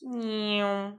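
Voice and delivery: silly cat sound effect; playful meow. Pretend. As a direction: meow like a cartoon kitten while painting. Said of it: "sound effects very necessary".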